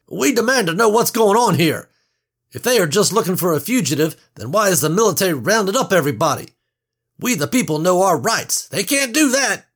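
loud, high, fast blustering; indignant demand. A narrator's reading. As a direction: rant about his rights. Portly man demands answers.

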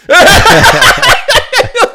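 loud, high real laugh bursting out; laughing at the teammate who fumbled; high burst of laughter. Real, not acted. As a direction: crack up at your own joke. Laughs at own cursing.

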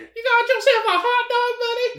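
high put-on voice, teasing; mock character voice. Teasing friend over a hot dog, in a high goofy voice. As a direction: squeak it up like a goofy cartoon.

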